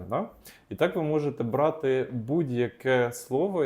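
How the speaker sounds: ordinary talk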